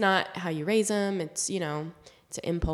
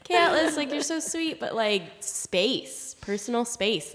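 put-on high, sing-song coaxing to a child; sweet parent talking to a toddler; gentle mom voice to toddler; 4 semitones higher than usual. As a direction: coax the little one kindly. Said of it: "I was like".

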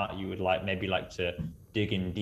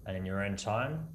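calm grounding exercise; 7 dB quieter than usual. Acted. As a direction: gently bring listeners back. Grounding back to the room.

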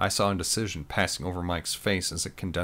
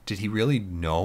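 ordinary delivery

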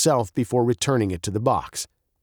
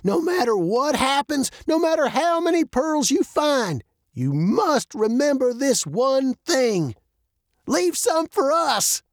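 high voice slowing to a punchline; old man, mock solemn; 13 semitones higher than usual. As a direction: build up a grave warning, then draw out the joke.

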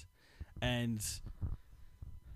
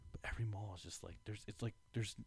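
whispered, trailing off; whispering; 3 semitones lower, 16 dB quieter, faster than usual. Real, not acted. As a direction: drop to a whisper. Voice drops to whisper.

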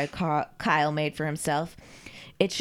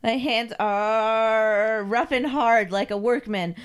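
raised, hammed-up whine; whining submissive servant; 4 semitones higher, 4 dB louder than usual. Carl complains to his mistress, whining. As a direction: whine to your mistress, rising as you go.

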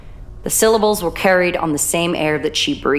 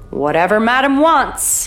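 quipping; 6 semitones higher than usual. Acted. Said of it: "he quipped".

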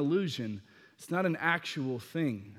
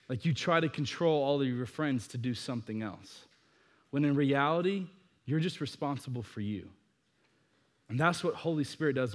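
ordinary talk

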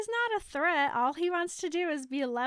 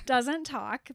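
ordinary talk, nothing marked

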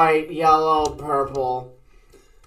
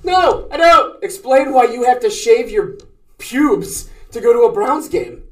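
loud; 6 semitones higher, 5 dB louder than usual. Real, not acted. Demanding friend explain himself.

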